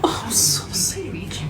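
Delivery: whispered, slow pet name. Real, not acted. Owner whispers a pet name to her dog. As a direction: breathe it softly at the dog.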